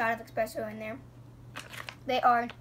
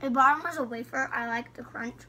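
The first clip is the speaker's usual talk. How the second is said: chewing candy between words; talking while chewing; faster than usual. Real, not acted. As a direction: mouth partly full. Seen 0: Girls hold bitten candy, chewing during the labelled words.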